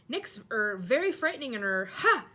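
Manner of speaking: mocking, stumbling yell. A narrator's reading. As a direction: bluster through it, then bark a laugh. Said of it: "yelled Sir Patrick's head".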